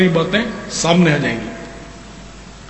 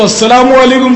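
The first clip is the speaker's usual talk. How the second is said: loud cheerful greeting; 5 semitones higher, 4 dB louder than usual. Retold or imitated. Greeting an angry driver.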